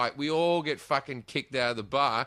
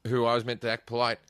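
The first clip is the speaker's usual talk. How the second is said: normal talking voice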